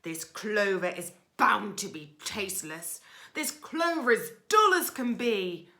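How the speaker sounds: loud, scornful highway rat; loud, sneering robber rat; ordering rat. Acted. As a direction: bark the orders like a bully rat. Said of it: "he ordered".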